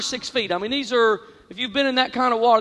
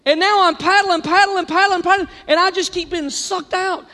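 frantic; 5 semitones higher than usual. Retold or imitated. Frantic paddling against riptide.

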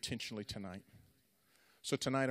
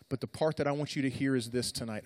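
ordinary talk, nothing marked

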